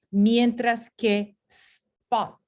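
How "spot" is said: "spot" slowly, with no puff of air after the p; "spot" with the p unaspirated. Shown as a demonstration. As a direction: keep the p in "spot" soft, with no breath. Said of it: "aquí no hay aspiración".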